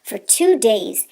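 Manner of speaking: plain reading voice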